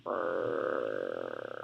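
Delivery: long low grumbling urgh; deep weary groan; low drawn-out groan. Real, not acted. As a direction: sink the groan down low and drag it. Frustrated with editing.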